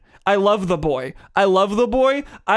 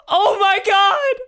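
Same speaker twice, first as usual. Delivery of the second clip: real whooping joy; excited shout. Real, not acted. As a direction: shriek with delight at the huge hit. Boss takes huge damage, cheering.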